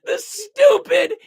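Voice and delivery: high, slow, drawn-out words through real laughter; laughing in disbelief. Real, not acted. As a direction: struggle to get the insult out while cracking up. Cracking up at the team's blunder.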